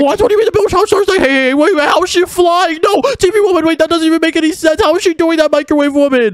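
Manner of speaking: high-pitched, incredulous outburst; flabbergasted. Acted. Player sees TV woman flying, flabbergasted. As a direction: squeal in baffled disbelief. Challenge starts, rival starts flying.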